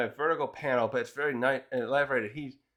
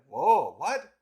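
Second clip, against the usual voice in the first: astonished; 3 dB louder than usual. Retold or imitated. Captain astonished at the bar.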